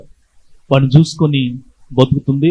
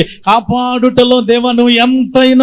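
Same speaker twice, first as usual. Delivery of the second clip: preaching; 9 semitones higher, slower than usual. Real, not acted. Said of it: "preacher slows and rises".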